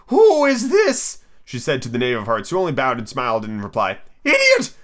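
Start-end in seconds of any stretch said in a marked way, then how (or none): 0.1-1.2 high, loud, drawn-out demand; severe Queen. A narrator's reading. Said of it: "the Queen said severely". 4.2-4.7 very high, loud outburst; impatient Queen. A narrator's reading. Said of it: "tossing her head impatiently".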